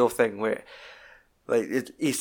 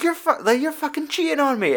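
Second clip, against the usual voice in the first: high-pitched accusing wife; mock hysterical accusation; imitated meltdown; 13 semitones higher than usual. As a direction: mimic an outraged wife, voice pitched right up. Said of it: "she's having an absolute fucking meltdown".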